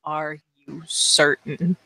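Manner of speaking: slow, low-key, barely voiced question; mouthing the words. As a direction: mouth it slowly, holding the voice back. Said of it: "just kinda mouth the words".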